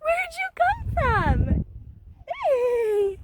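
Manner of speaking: high, loud, slow delighted question; excited coo. Real, not acted. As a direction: gush over the kitten. Cooing at the cat.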